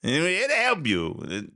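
high, mocking official's cheerful promise; sarcastic impression of a cheerful government official; mock earnest misquote. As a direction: parody the bureaucrat's eager reassurance, slowing at the end.

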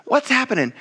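a hint of confused looking around; mock distracted Jesus. Jesus imagined turning around puzzled, mock confusion. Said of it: "Jesus doesn't turn around and say".

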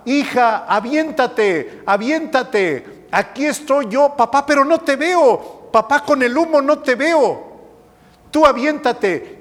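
high voice calling up through smoke. Retold or imitated. Father calls daughter in burning building, high urgent call. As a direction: shout up to the trapped girl.

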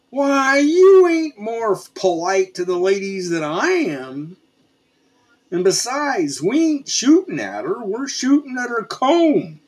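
hoge, opgewonden stem van Andy; Andy argues high. Acted. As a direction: argue back, pitched well up.

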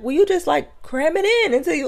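very high, casual mock girl; high girly voice. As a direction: say it high and carefree. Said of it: "She was like".

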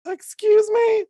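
shocked and offended at the phone's nerve; sassy disbelieving squeak at the phone; outraged disbelief. Retold or imitated. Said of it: "I'm like".

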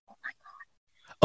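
breathless slow whispered dismay; retold whisper. Told by her colleague she sounds upset, a slow shocked whisper. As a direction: whisper it, caught out. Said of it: "And I was like".

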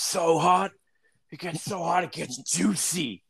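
high, straining cowboy promo voice; Terry Funk. Retold or imitated. Podcaster quoting a wrestler's promo, high strained impression. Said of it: "I'm talking about that soft-spoken".

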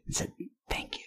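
very soft imitated whisper; whispered pigeon voice. Dying pigeon to the driver who hit it, mock whisper. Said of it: "this pigeon whispered to me".

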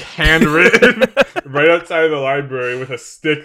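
ordinary delivery

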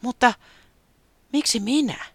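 high, small bird voice in wonder; Taputi asking. A narrator's reading. Taputi asking the ghost why, high and wondering. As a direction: ask it like a humbled little bird.

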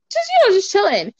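high, quick, breezy brush-off; mock casual. Telling the guy she's just chilling, mock casual. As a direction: play it cool, tossing it off fast. Retelling her reply to the guy.

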